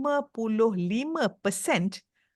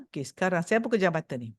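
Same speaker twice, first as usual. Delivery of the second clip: normal talking voice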